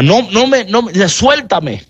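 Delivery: playful let-go-of-me; Jesus pushing her away. Acted. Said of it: "Jesús luego le dice".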